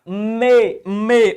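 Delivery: "Me" held long and loud; stressed syllable. Shown as a demonstration. Teacher answering which syllable is tonic, emphatic "Me". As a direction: punch out "Me" on its own, twice. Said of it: "Qual a sílaba tônica, rapaziada?".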